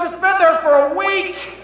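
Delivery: high, loud, slow mock yell; wife shrieking at husband; shouted wife. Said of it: "She goes".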